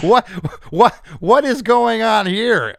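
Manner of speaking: high, loud, drawn-out playful bafflement; mock disbelief. Host asking songwriter about rude lyrics, mock disbelief. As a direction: demand it slowly and loudly, amused and baffled.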